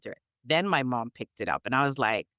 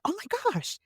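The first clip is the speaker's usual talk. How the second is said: mock surprise; 3 dB quieter than usual. Imitating surprised reaction.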